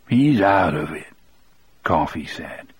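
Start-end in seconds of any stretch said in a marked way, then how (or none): 0.1-1.1 weary. A narrator's reading. Coffey speaks to the guard.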